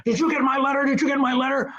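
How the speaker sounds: high, urgent mock Stan; storming Stan. Stan storming out of his office, high and urgent. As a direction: fire it at him like an eager boss. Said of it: "he came storming out of his office".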